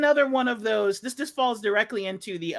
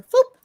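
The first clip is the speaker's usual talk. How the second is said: whoosh sound; 9 dB louder than usual. Pretend. Mimics a ship through a wormhole.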